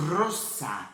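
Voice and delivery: "roça" with a rolled r at the front of the mouth; rolled Spanish r. Shown as a demonstration. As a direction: trill the r of "roça" with the tongue. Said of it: "Já quando eu falo".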